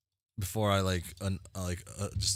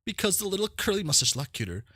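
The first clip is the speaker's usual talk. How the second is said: very high, quick fawning voice; high-pitched starstruck host; gushing game character; 13 semitones higher, 4 dB louder, faster than usual. Acted.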